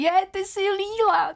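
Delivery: high-pitched, thrilled exclamation; excited recognition. Retold or imitated. Strangers recognising the actress from her cartoon role, excited. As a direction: imitate a fan squealing in recognition. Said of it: "tak všichni".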